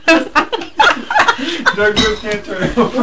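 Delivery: slow, breaking into laughter; laughing. Real, not acted. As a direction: laugh between the words. Group laughing fit.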